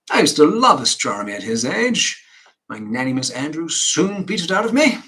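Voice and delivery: loud, emphatic declaiming father; big theatrical reminiscence; pompous father. Acted. Acting stern father reminiscing.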